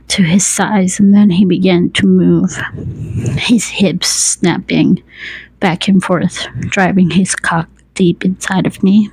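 plain reading voice

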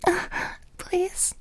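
breathless begging whisper; pleading whisper. Acted. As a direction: beg softly, almost voiceless. Begging in intimate scene.

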